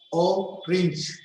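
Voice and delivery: "Orange" broken as o-range; split in two syllables. Shown as a demonstration. Teacher counting syllables for a table, broken in two. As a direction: say "Orange" as two separate beats. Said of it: "two syllable".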